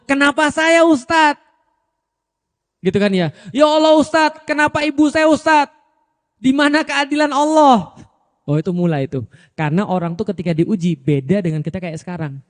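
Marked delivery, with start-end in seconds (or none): 0.1-1.4 high, pleading complaint; whiny complaining student. Retold or imitated. Student complaining to his teacher, high and pleading. 3.5-8.1 high, pleading lament; lamenting student. Retold or imitated. Student bewailing his mother's covid to the ustadz, high pleading.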